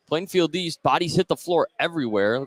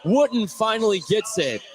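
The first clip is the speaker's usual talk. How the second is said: high, raised commentator's call; excited play-by-play; 7 semitones higher than usual. Real, not acted. Announcer to listeners as Wooten finally scores, excited. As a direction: let the long-awaited payoff ring out. Basket after repeated missed chances.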